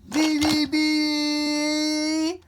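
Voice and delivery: high, loud, slow honking; long horn blast. Pretend. Pretend driver honking at someone blocking the road. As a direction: lean on the horn.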